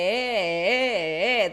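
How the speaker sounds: loud 데 held with a singing wobble; long vibration. Shown as a demonstration. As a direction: stretch 데 out in waves. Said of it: "like vibration".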